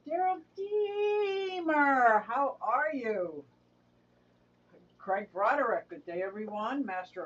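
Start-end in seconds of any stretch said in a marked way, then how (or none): 0.1-3.4 drawn-out excited greeting. Real, not acted. Greeting viewer in chat.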